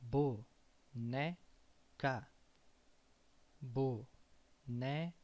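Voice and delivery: boneca slowed, with né stressed and open. Shown as a demonstration. Teacher models boneca slowly for learners, né stressed. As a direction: stretch boneca, leaning on né. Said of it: "The second one".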